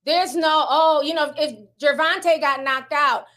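ordinary talk, nothing marked

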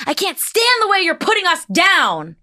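high, mock indignant heckler; angry heckler. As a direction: play the offended audience member. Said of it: "was like".

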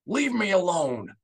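high, loud, raw snarl; grief-stricken snarl. A narrator's reading. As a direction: shove the aide away in grief. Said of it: "Bel Iblis snarled".

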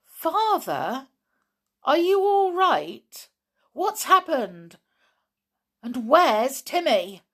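high, loud anxious questioning; urgent. A narrator's reading. Daughter questioning her father about the missing dog, urgent. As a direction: press urgently for answers. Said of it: "said George urgently".

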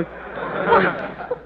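high startled gasped what; shocked disbelief; wife stunned by the news. Acted.

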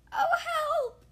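very high, loud panicked cry. Acted. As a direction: scream for help mid-fall. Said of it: "they both cried together".

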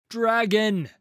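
loud, high commanding shout of the word; booming cry. A narrator's reading. Warrior Pantheon summoning the dragon, booming cry. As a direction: boom it out like a war cry. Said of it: "She cries out with a voice that booms".

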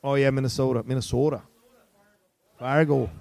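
low mock Minnesota accent. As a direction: lean into a Fargo-style Minnesota accent. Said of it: "It sounds more like Minnesota".

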